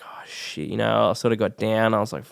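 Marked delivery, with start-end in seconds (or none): 0.0-0.7 a crushed, almost silent curse; deflated whisper. Retold or imitated. Rejected by the drama school, he whispers his letdown. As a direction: breathe it out under your breath, defeated.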